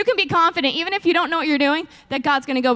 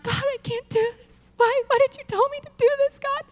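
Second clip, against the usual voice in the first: higher, tearful mock plea; retold crying prayer; 10 semitones higher than usual. Crying alone in an office, tearful mock plea. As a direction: plead through half-laughing tears. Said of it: "I was crying".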